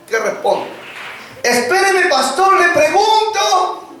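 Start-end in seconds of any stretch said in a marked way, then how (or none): 1.4-3.9 put-on high, slow little girl voice; high, drawn-out child answering the pastor. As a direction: play the sweet child on the phone.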